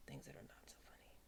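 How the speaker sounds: a low, hushed whisper; whispered aside to the viewers. Real, not acted. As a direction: drop into a secretive whisper. Aside about unfunny topics.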